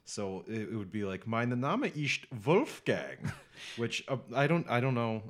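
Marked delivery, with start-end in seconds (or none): 1.3-3.2 slow, exaggerated German accent on Mein Name ist Wolfgang; over-the-top German accent. Shown as a demonstration. Student showing off his silly German accent to the teacher. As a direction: ham up a thick German accent. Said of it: "my German teacher said my accent was inappropriate".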